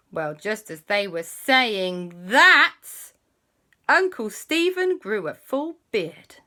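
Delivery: plain reading voice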